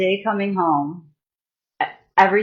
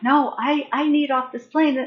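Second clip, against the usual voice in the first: higher, pleading insistence; desperate plea; 6 semitones higher than usual. Retold or imitated. To the flight crew, pleading to get off the plane. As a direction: beg to get off, voice climbing.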